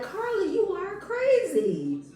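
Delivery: high-pitched, drawn-out disbelief; exaggerated incredulous exclamation; mock exasperation. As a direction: stretch it out slowly in a high wail. Acting out own reaction to Carly.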